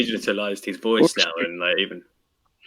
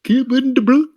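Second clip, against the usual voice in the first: much higher put-on voice; playful high voice; 14 semitones higher, 3 dB louder than usual. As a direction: say the name in a silly high voice. Playful put-on voice naming captain.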